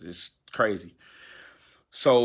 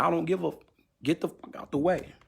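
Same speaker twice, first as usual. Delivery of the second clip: retold driver's yell, pitched high; mock angry yell; 8 semitones higher than usual. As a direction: imitate the driver shouting at him, higher. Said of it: "then he's like".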